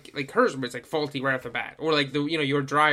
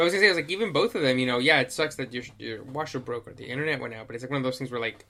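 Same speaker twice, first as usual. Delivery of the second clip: ordinary talk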